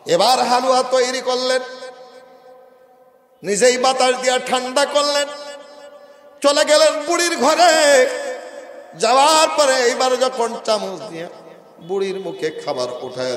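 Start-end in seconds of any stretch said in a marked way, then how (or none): 0.1-11.3 high, loud sung-out narration; preaching at full force. A narrator's reading. Preacher telling of halua fed to the old woman, high loud chant. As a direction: belt out the tale in a sermon chant.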